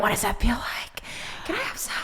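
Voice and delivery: sneaky whispered request; mock whisper. Joking she wanted the drugs too, playful whisper. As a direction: whisper it like asking for contraband. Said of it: "And I'm here like".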